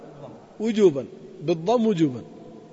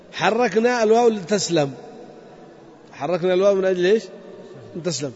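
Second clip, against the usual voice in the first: ordinary delivery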